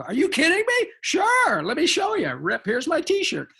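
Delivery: high, gushing mock fan reaction; thrilled fan. Actor imitating fans who hear his cartoon roles, thrilled. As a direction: leap high in pitch with exaggerated eagerness. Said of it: "and they go".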